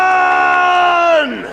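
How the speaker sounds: huge high drawn-out yell of Khan; shouting Khan. Pretend. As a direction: bellow Khan with everything. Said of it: "Can you shout Khan".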